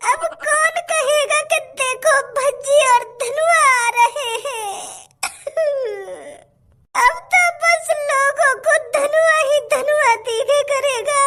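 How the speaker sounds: slow, hammed-up sobbing wail; milk the tears with a drawn-out mock sob; fake crying. Friend mourns Bhajji's death sentence with hammed-up sobbing. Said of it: "काय को नाटक कर रहा तू मेरे सामने रोने का".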